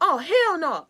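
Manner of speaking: loud, high mock outrage; imitated mad refusal. Voicing the resentful person's reaction, loud angry refusal. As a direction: burst out indignantly as the offended person. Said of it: "this person was so mad".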